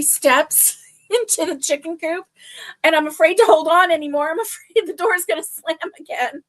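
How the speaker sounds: high, loud, laughing through the words; laughing while retelling. Real, not acted. Woman retelling being stuck on coop steps, laughing. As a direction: tell the mishap through rising giggles. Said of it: "I'm laughing about it now".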